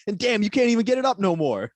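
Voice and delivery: high, loud scolding wife; woman yelling at her man in a mock commercial. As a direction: yell at him like a fed-up wife.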